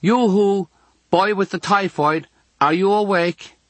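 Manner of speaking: put-on high girlish call; girl's voice. A narrator's reading. Girl calling to boy with typhoid, high girlish voice. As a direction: call brightly to a stranger, pitched well up. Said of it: "a girl's voice says".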